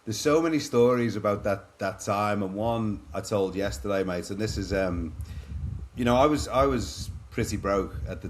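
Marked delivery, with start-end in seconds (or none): none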